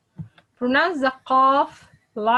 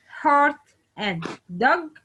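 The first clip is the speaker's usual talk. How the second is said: normal talking voice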